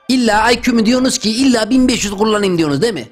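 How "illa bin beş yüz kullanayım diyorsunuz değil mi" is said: ordinary talk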